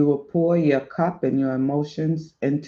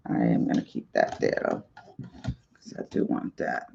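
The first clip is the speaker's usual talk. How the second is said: whispered aside; 11 dB quieter than usual. Real, not acted. Muttered aside while arranging cards.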